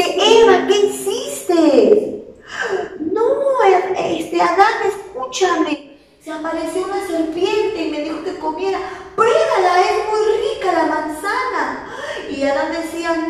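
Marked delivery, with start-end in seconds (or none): 0.0-2.4 high, slow dismayed Adam; Adam reproaching. Acted. Adán reprocha a Eva. 3.0-11.9 pleading Eve. Acted. Eva se excusa ante Adán.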